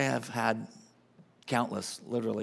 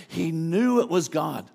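very slow, higher and much louder; emphatic preaching; 5 semitones higher, 7 dB louder, slower than usual. Real, not acted. Pastor insisting to the congregation, very slow and loud. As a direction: stretch each word out, loud. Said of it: "preaching at full force".

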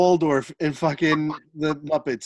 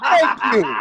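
loud, higher thank you; hammed-up cry of thanks; mock grateful shout; 6 semitones higher, 4 dB louder than usual. Mock grateful outburst, laughing.

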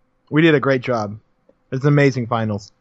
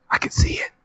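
whispering; 4 dB quieter than usual. Pretend. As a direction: breathe it like a secret. Dramatic whispered hype.

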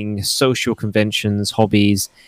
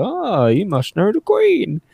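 higher, drawn-out starry-eyed voice; naive mock question; 10 semitones higher, slower than usual. People who think England is tiny, gushing slow and high. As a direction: mimic people gushing about the royals. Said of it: "people who go".

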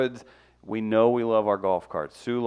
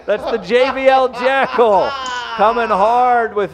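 hyped reading; 6 semitones higher, 5 dB louder than usual. Acted. Reading submission with flourish.

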